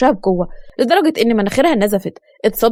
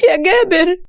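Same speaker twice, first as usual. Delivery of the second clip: high, anguished plea; acted panicky regret; frightened pleading; 7 semitones higher than usual. A narrator's reading. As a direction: call out to someone hurt, pitched up in fear. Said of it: "وقربت منه بخوف".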